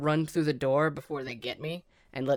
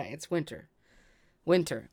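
ordinary talk

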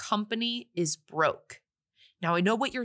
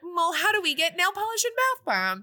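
high, slow, laughing ditzy voice; clueless rich girl; 10 semitones higher than usual. Retold or imitated. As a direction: play it airheaded, much higher and slower. Clueless rich girls voiced asking.

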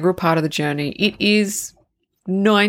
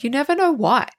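normal talking voice

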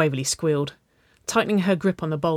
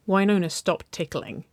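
usual speaking voice

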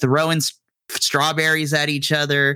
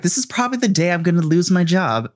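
exasperated despair; 5 semitones higher, faster than usual. Retold or imitated. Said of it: "I was like".

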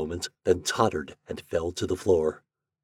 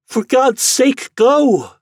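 much higher, louder, slow desperate shout; anguished cry; 10 dB louder, much slower than usual. Acted. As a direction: drive him away in anguish. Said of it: "she cried".

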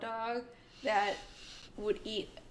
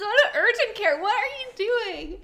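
high, loud mock outrage; exasperated shout; 11 semitones higher, 11 dB louder than usual. Vet staff sending bitten owner to doctor, mock exasperated shout. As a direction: yell in comic disbelief, laughter underneath. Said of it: "And we were like".

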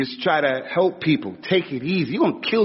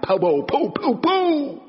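popping noises rising high, like fireworks going off; firecracker pops; 12 semitones higher, slower than usual. Retold or imitated. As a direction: mimic fireworks bursting. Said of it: "He's like that".

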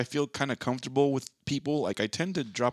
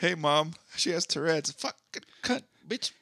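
higher, blurting swears at Mom; mock Tourette's outburst; 6 semitones higher than usual. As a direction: fake a tic of cursing. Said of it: "Yeah, why not?".

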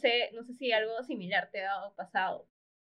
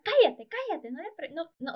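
higher, louder sharp scolding, dropping off at the end; scolding hush; 6 semitones higher, 5 dB louder than usual. Retold or imitated. Mother hushing her curious son in the street, sharp and scolding. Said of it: "le gritó feo".

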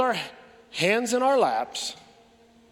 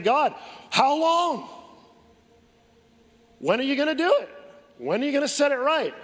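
pleading cry; 5 semitones higher than usual. Retold or imitated. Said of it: "crying out to God".

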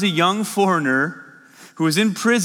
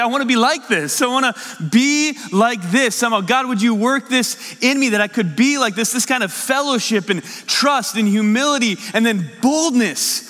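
higher-pitched, fervent pleading; earnest, rising preacher's appeal; impassioned prayer; 5 semitones higher than usual. Retold or imitated. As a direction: plead with God from the pulpit. Heartfelt prayer retold to congregation.

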